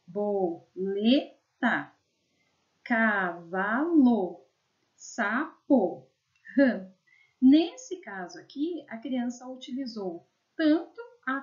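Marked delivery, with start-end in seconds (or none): 0.1-6.9 slow word reading. Shown as a demonstration. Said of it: "teacher reads child's words slowly".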